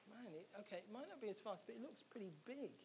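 hushed, doubtful aside to himself; very soft, retold misgivings; wary inner thought. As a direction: drop right down in volume, sizing it up uneasily. Retells wary thoughts before next ride.

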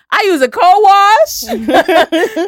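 high, loud, drawn-out comic delivery with laughs; exaggerated mock answer. Answering a stranger about her hair, in mock high exaggeration. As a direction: sing out each hair step, slowly and playfully.

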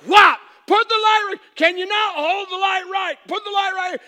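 hammed-up father losing patience; mock angry dad shout. Dad yelling at son.

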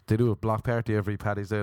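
ordinary talk, nothing marked

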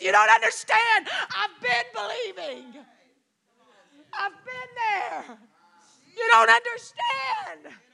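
high, loud and breaking, slowing down; tearful protest. Retold or imitated. Grieving woman tells the kneeling friend, tearful protest. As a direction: sob it out, pushing the comfort away.

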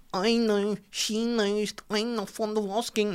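high breathy singer-songwriter croon; mock an affected pub singer; put-on singer voice. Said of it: "a voice that isn't his actual voice".

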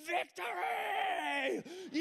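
high, drawn-out triumphant shout; fist-in-the-air victory cry; victory scream. Retold or imitated. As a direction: yell it like a kid who just won a fight. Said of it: "just screaming".

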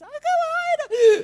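very high, loud gasping squeal; high, breathless prophesying. Retold or imitated. Zambian lady trying to prophesy, high gasping wail. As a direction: imitate someone squealing and gulping for air as they prophesy. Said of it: "my voice goes high and I can't breathe".

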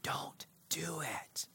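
slow soft whisper; whispered warning. Acted. Preacher voicing God, a slow whispered warning. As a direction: hush it out like a last plea. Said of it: "God will whisper warnings".

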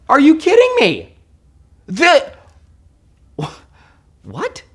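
high, loud disbelief; can't believe it; incredulous. Real, not acted. Preacher exclaiming in disbelief at the congregation.

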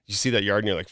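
ordinary talk

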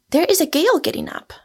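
quick, high-pitched sudden remark; abrupt high, hurried announcement; sudden exclamation. A narrator's reading. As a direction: burst out with it suddenly, high and fast. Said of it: "said Rosamond suddenly".

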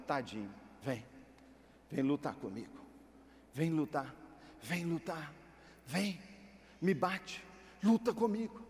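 soft, low mock challenge; God goading Jacob to wrestle, low and soft; teasing God. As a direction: coax him into the ring playfully. God taunting Jacob to fight.

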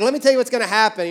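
hammed-up know-it-all Jesus laying it out quick; mock stern Jesus. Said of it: "Jesus is like".

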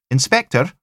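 high, loud lilting Highland call; bright Highland hail from afar; sing-song Highland accent. A narrator's reading. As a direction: wave and call him in a Highland lilt. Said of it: "sing-song Highlands and Islands accents".

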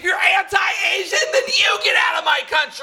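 shrieking shout. Acted. Escalating mock patriotic shouting match.

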